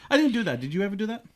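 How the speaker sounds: ordinary delivery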